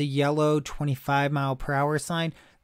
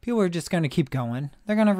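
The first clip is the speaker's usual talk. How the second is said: normal talking voice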